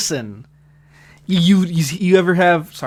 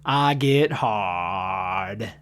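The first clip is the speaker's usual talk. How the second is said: Forrest Gump impression; 5 semitones lower, much slower than usual. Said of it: "auditioning for the role of Tom Hanks".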